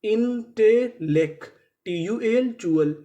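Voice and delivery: "Intellect" slowly in separate chunks, in-te-lekt; drawn-out, segmented "intellect" for learners; syllable-by-syllable reading. Shown as a demonstration. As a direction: sound out "intellect" slowly, each part held. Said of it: "तो meaning क्या बनी".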